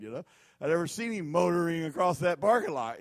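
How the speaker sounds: ordinary talk, nothing marked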